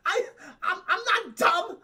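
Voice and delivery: high, loud whiny Fredo rant; Fredo's outburst. Retold or imitated. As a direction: shout it like the passed-over brother. Streamer acts out Fredo's outburst.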